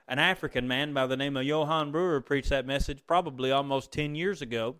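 ordinary delivery

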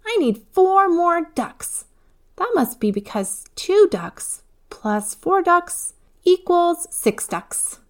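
put-on high, slow child voice working it out; a proud small girl spelling out the sum; little girl explaining. A narrator's reading. Eva solving her duck sum, high and slow.

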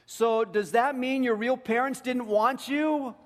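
high, loud, drawn-out quoted question; girl's question. Retold or imitated. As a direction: read the girl's question out slowly, pitched well up. Reads the girl's pointed question aloud.

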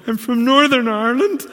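very high, loud mock-panicked reply; hammed-up flustered answer, far too loud; acted nervous blurt. Startled visitor answers a stranger in church, mock panic.

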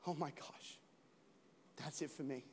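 a woman leaving the clinic in soft, hushed despair. Retold or imitated. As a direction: breathe out the bad news quietly. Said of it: "a woman was leaving a medical clinic going".

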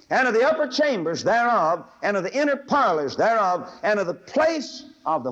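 loud, high scripture reading; preached scripture reading. Acted. Preacher reading the Bible aloud to the congregation, loud. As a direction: proclaim the verse at full voice.

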